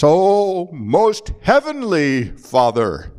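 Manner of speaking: a dramatic "Oh", then "most heavenly Father" drawn out slowly; showy prayer voice. Shown as a demonstration. Preacher mocking a showy televised prayer, drawn-out pious voice. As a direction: ham up the pious tone for the cameras. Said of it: "It might start off something like this".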